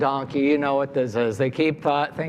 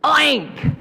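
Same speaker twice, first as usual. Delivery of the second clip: one high, loud, clean oink; successful pig oink; 12 semitones higher, 5 dB louder than usual. A narrator's reading. Storyteller as pig who finally went oink, high triumphant squeal. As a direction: burst out a triumphant short oink. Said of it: "the pig finally went, oink".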